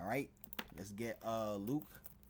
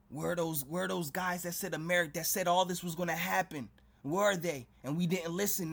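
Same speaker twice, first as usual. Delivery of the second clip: higher, louder frantic questions of lost people; desperate crowd; 5 semitones higher, 6 dB louder than usual. Retold or imitated. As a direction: cry out like someone who missed the warning. Voices panicked people seeking prophets.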